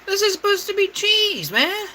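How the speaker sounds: high, whining exasperation; whiny complaint. Real, not acted. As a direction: whine it out in disbelief. Complaining about the spicy cheese.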